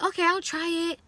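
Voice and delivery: pitched well up, hopeful try-it voice; hopeful patient. Retold or imitated. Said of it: "you're like".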